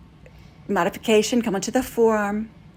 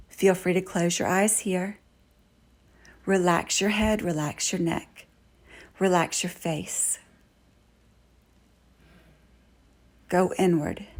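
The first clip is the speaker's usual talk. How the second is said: much softer, calm relaxation cue; gentle settling voice at the end of a pose; calm, soothing guidance; 3 semitones lower, 6 dB quieter than usual. Shown as a demonstration. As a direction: ease them into stillness, softly. Yoga teacher relaxing the class.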